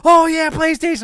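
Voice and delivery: high, loud mock enthusiasm; imitating an eager fan; excited fan. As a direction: gush like a fan spotting the logo. People reacting to tattoo.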